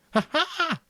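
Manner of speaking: high wild ha ha; excited laugh. Acted. Jack sees his masked face and laughs excitedly. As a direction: burst into a wild, gleeful laugh. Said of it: "laughing excitedly".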